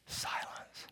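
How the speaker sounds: barely-there whisper; hushed aside. Acted. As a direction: whisper it into the awkward gap. Awkward silence after being asked.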